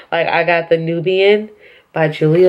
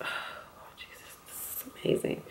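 whispered to oneself in real amazement; awed whisper; 22 dB quieter than usual. Real, not acted. Vlogger overwhelmed by the new palette, awed whisper. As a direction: sigh out into a tiny whisper.

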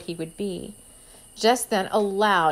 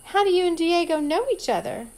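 put-on prying girl; 9 semitones higher, 5 dB louder than usual. A narrator's reading. Amber prying, high voice.

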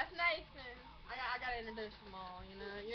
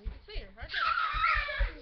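excited shout; 8 dB louder, slower than usual. Real, not acted. Exclaiming at kids.

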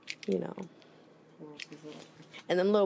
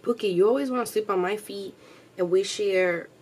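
voicing the dog; 2 semitones higher, 7 dB louder than usual. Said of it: "She's like".

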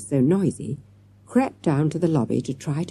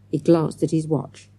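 ordinary delivery

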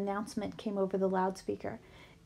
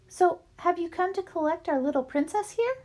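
higher, sweet lunch lady; 7 semitones higher than usual. A narrator's reading. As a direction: coo over the little girl. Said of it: "the orange hairnet lady said".

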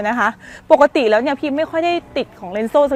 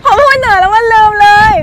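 laughing wail; 12 semitones higher, 13 dB louder than usual. Real, not acted. Flubbed line, laughing at herself.

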